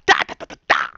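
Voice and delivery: low rapid mouth gunfire, ta ta ta; gunfire imitation. Mocking the soldiers firing on people, imitated gunfire. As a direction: rattle it off like a machine gun.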